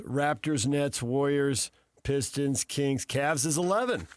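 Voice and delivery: usual speaking voice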